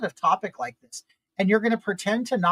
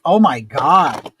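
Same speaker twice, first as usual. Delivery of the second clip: exasperated groan; 4 semitones lower, 8 dB louder, much slower than usual. Retold or imitated. Exasperated at being passed around.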